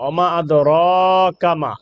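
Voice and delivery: ওমা আদরাকা মা, মা-তে তিন আলিফ লম্বা টান; long vowel stretch. Shown as a demonstration. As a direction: stretch the মা long, three alif. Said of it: "তিন আলিফ টানবো". (ওমা আদরাকা মা — মা অনেক লম্বা টেনে)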